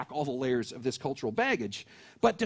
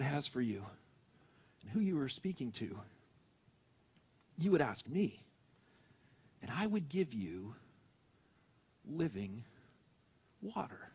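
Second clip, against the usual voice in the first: soft, quiet and tender, fading lower; compassion and love; 4 semitones lower, 7 dB quieter than usual. Acted. Jesus tenderly offering living water to the woman, soft and loving. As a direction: gently offer the gift, almost hushed. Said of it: "with a tone of compassion and love in his voice".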